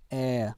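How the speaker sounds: "air" drawn into "eh-uh", no R; British "air" as a gliding diphthong. Shown as a demonstration. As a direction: say "air" British-style without R. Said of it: "R 사운드는 없지만".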